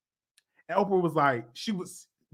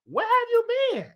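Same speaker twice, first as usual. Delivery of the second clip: incredulous squeal. Retold or imitated. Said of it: "I'm like".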